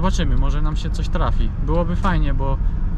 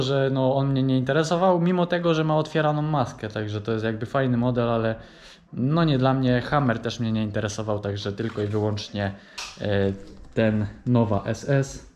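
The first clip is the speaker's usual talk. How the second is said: normal talking voice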